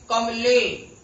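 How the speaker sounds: कमले with ले held long and loud; stress on ले. Shown as a demonstration. Teacher showing where the stress falls, loud long ले in कमले. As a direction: lean hard on the long ए ending of कमले. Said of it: "एक ही मात्रा पर बलाघात हो रहा है".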